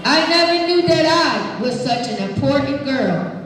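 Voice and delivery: put-on expressive read-aloud to kids; reading to children. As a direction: read it warmly to the class. Reading picture book aloud.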